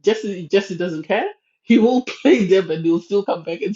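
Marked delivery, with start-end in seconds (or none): none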